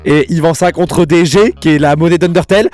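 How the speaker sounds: excited. Real, not acted. Excited rant about fan theory.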